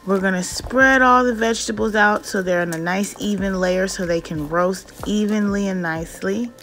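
normal talking voice

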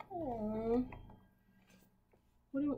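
ordinary talk

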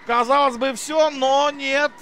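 rising excited commentary, laughing; thrilled live goal call; excited hockey goal call. Real, not acted. Goal scored, commentator erupts.

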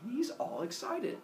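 ordinary delivery

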